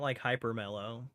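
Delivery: normal talking voice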